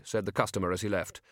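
plain reading voice

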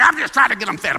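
dad answering mom, defending himself; defensive dad. Retold or imitated. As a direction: push back like a harried father. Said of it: "And Daddy's like".

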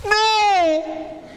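put-on high, loud child shriek; loud toddler scream. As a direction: blast a toddler's defiant no. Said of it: "Sorry, that was really loud".

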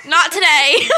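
laughing, shouted brush-off; giggling no; laughing retort. Retold or imitated. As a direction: toss it back with a laugh. Mocking refusal of pickup line.

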